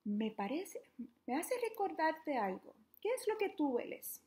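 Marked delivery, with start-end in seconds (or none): 3.0-4.2 put-on high, bright question to children; asking the kids. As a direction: lift the voice way up to ask the little ones.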